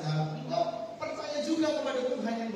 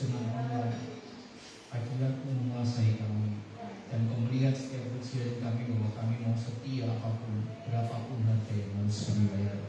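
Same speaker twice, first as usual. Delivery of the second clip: low, hushed praying voice; prayer; 8 semitones lower than usual. Acted. Pastor leads opening prayer.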